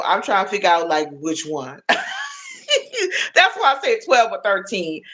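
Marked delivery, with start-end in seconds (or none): 1.8-5.0 laughing. Real, not acted. Laughing at viewer's age.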